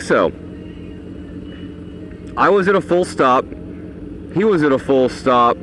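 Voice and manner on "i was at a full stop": usual speaking voice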